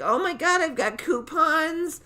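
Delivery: high, slow, drawn-out mom voice; hammed-up pushy customer, loud and stretched; mom at checkout. Mom haggling in his checkout aisle, mock high-pitched mom voice. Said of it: "my mom goes through my checkout aisle".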